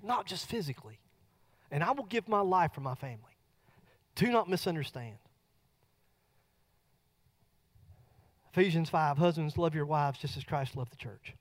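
soft, intimate sermon voice; a pastor quietly urging husbands in the church; soft earnest preaching. Acted. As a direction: lean in and preach gently from the heart. Preaching softly about husbands.

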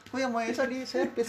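high, soft, puzzled imitation; mock mechanic voice. Mechanic's reply in a retold joke, high mock puzzlement. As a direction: ask it up high and quietly, a lightly voiced confused mechanic.

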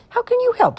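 high, loud little-creature whine; sniffly little dragon. A narrator's reading. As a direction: whine it doubtfully like a small dragon. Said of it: "sniffed the littlest dragon".

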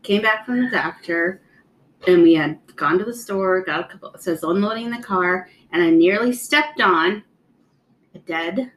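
ordinary talk, nothing marked

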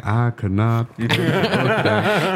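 slow, lightly voiced Southern Clinton drawl; a hint of Bill Clinton, stretched out. Son redoing his dad's line as a mild Bill Clinton. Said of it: "like a little Bill Clinton thing".

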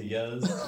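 "yes" standing for "yous"; laughing Delaware "yiz", spelled "yes"; Delaware slang plural. Shown as a demonstration. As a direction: stretch "yes" out as a dialect "you all". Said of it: "they'll say yes".

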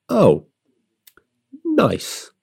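high, drawn-out mock "nice"; a high, amused "Oh, nice"; mock impressed. Girlfriend teasing him in bed, mock-impressed. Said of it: "And then she burst out laughing".

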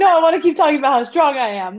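put-on high, loud insistent kid voice; imagined little boy. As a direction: insist like a proud small boy. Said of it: "He's like".

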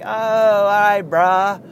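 put-on high, loud San Diego surfer-bro accent. Easygoing fans forgiving a bad game, San Diego accent. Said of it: "That's my San Diego accent".

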